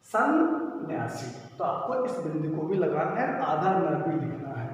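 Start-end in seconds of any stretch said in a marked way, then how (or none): 0.1-1.3 सन्यासी drawn out slowly and clearly; slow model word. Shown as a demonstration. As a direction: read सन्यासी slowly like a teacher. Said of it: "अब ये आपका हो गया सन्यासी".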